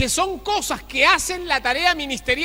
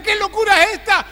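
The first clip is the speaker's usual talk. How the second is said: astonished crowd; 8 semitones higher, 7 dB louder than usual. Acted. Crowd reacts to sudden healing.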